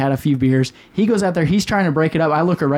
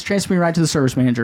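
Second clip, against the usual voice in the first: usual speaking voice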